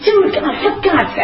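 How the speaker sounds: high, laughing disbelief, come on; exasperated. Retold or imitated. To her sponsor, laughing off his diagnosis, exasperated. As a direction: scoff at him with a laugh through it. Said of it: "I said".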